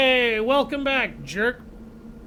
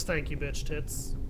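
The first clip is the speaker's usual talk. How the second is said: softer, slow, offhand "Thank you, bitch tits."; most casual; 6 semitones lower, 10 dB quieter, slower than usual. Retold or imitated. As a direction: drop it lower and softer as if nothing happened. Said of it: "Patton just with the most casual thing in the world".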